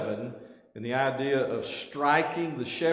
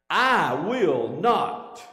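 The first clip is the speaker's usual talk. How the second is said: "I will not", very slow, higher and louder, each word separate; emphatic refusal; 4 semitones higher, 6 dB louder, much slower than usual. Retold or imitated. Peter answering Jesus again, emphatically.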